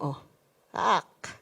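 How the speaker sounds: ordinary talk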